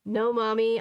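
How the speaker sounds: usual speaking voice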